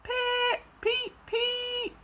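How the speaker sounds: very high piping toots; whistle-like peep. A narrator's reading. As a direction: chirp it like a steam whistle. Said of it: "whistled Henry".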